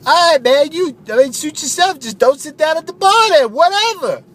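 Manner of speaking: high, loud goofy bro voice; Phil the ad pitchman. Acted. Radio ad buddy Phil calling to Steve, high loud bro voice. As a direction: belt it like a cheesy radio ad buddy.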